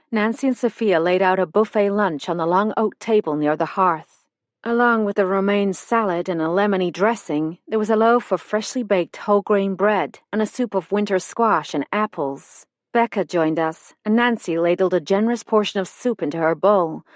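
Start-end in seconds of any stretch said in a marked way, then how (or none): none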